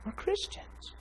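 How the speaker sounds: put-on high, whispered girlfriend voice; imitated hushed reminder. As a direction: whisper it earnestly, imitating her. Looked me right in the eyes.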